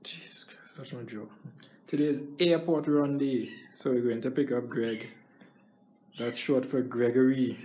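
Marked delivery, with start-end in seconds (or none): 0.0-1.3 pained whisper. Real, not acted. Pain while clearing throat.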